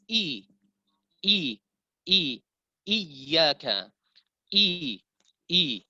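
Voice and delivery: a full, clean "i" with firm glottal onset; complete kasrah. Shown as a demonstration. Teacher drills the vowel, kasrah fully sounded. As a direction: isolate "i" sharply, then "iyyaka". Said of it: "menyempurnakan kasrah tersebut".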